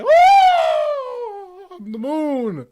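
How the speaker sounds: put-on very high, loud whooping Jamaican accent; mock Jamaican boss. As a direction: whoop it like a hammed-up Jamaican moon god. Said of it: "he's a tentacle Jamaican man".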